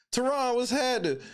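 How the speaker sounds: ordinary talk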